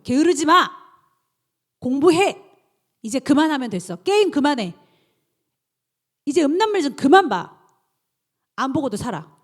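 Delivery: loud, commanding orders to yourself; firm self-command. Acted. Preacher orders herself to stop sinning, loud and commanding. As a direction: bark out each order like a strict coach.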